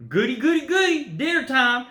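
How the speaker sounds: put-on high, gleeful hillbilly call, hillbilly accent. Pops spots his trapped dinner, high hillbilly accent. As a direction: crow it out like a hungry backwoods hillbilly. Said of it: "in some backwaters hillbilly accent".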